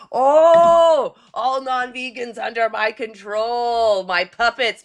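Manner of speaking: mad scientist. Pretend. Gloating villain controlling people through camera.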